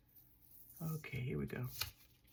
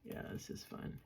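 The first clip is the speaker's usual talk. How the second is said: very soft, deadpan sarcasm; sarcastic; 13 dB quieter than usual. Real, not acted. Crafter fed up with peeling a sticker, sarcastic. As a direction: say it under your breath with dry sarcasm. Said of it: "I'm being sarcastic".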